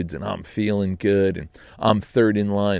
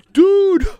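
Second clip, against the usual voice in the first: high, loud shout of dude; excited call; 9 dB louder than usual. Retold or imitated. Scott and Brian on speakerphone, excitedly shouting dude. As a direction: burst in with it. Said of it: "they go".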